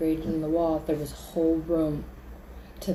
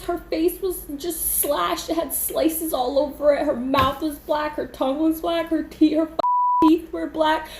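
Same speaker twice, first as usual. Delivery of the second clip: high, near-tears retelling, building louder; distraught panic; 8 semitones higher, 6 dB louder than usual. Retold or imitated. Telling friends about the girl's face, distraught panic. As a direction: relive the horror in a high, overwrought voice.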